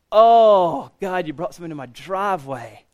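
mock-groan to God over a missed chance; anguished lament. Re-enacts regretful lament to God.

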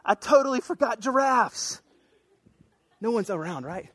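hammed-up "oops" moment; absent-minded God. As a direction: act out God suddenly remembering an oversight. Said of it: "God's like".

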